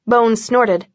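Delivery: ordinary delivery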